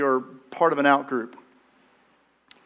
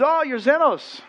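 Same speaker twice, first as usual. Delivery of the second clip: local mayor greeting; 8 semitones higher, slower than usual. Retold or imitated. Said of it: "And so he says".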